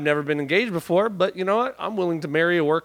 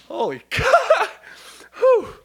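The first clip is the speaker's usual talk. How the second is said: laughing; slower than usual. Real, not acted. Recovering from laughing fit.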